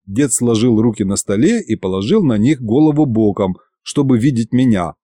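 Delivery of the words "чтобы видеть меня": plain reading voice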